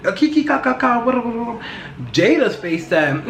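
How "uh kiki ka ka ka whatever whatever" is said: high mock cackle kiki ka ka ka; high, mocking imitation of laughter; imitating Will laughing. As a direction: imitate a man chuckling along at a joke. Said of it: "he was laughing".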